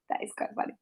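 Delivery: soft breathy aside to listeners; whispered aside. Real, not acted. Reader to listeners, amused: a hushed whispered aside. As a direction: let it slip out under your breath. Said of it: "aside whispered".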